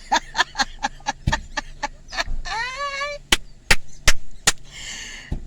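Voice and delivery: high, airy wheezing laugh; breathless laugh. Real, not acted. Mother recalling children's shock, breathless wheezing laugh. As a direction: laugh silently, all breath.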